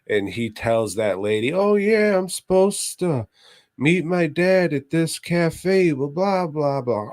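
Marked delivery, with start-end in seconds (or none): 1.5-7.1 put-on high, bright kid voice. Retelling the movie, voicing the boy high. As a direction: play the kid, pitched up high.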